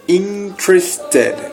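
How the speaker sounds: Interested said very slowly as IN-tres-ted, the E after T dropped; slow teacher demo of Interested; three-syllable model. Shown as a demonstration. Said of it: "como se fosse só três sílabas".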